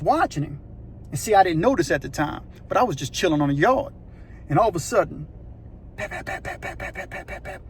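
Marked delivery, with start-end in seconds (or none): 6.0-7.6 tapping footsteps. Retold or imitated. Footsteps approaching in story.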